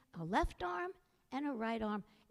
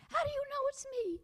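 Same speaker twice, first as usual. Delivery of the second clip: put-on much higher, louder boyish protest; Jack's voice; 12 semitones higher, 4 dB louder than usual. A narrator's reading. Jack protests to the skeleton, high and loud. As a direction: squeak it out. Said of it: "said Jack".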